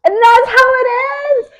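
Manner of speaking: very high, loud squeal of disbelief; exaggerated disbelief. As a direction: wail it in hammed-up shock at the ending. Mock outrage at the cliffhanger ending.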